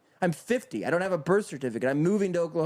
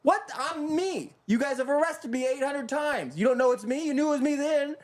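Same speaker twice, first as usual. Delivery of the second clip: much higher, incredulous mock voice; outraged imitation; 9 semitones higher than usual. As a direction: play the fed-up guy shouting in disbelief at the DMV, higher. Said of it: "they're like".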